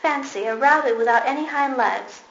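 loud jeering exclamation; mocking call. A narrator's reading. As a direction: jeer at him loudly. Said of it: "And he began to laugh".

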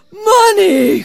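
high, loud cry of delight; greedy cry. A narrator's reading. Labai hears of gifts of money, greedy cry. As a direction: cry out, thrilled by riches. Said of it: "cried Labai".

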